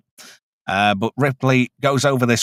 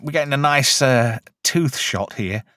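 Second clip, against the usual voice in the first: ordinary talk, nothing marked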